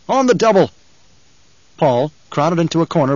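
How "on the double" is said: loud, high snapped command; snapped order. A narrator's reading. Halleck hurrying the rescued men, snapped. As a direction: snap it like an officer hurrying troops. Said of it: "he snapped".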